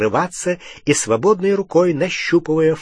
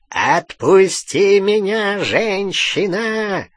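high, slow, strained growl; snarl; 7 semitones higher, 3 dB louder, much slower than usual. A narrator's reading. Fenrir demands release from Bellatrix, snarling. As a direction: growl it out, straining to get free. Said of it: "зарычал он в ответ".

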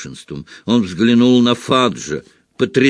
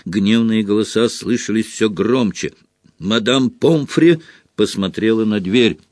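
ordinary delivery

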